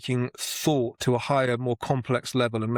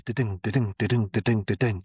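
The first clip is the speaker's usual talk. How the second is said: chanted "da-ding" with the beat on "ding"; iambic rhythm; 2 semitones lower than usual. Shown as a demonstration. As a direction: tap the iambs lightly. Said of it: "You can always get back on it".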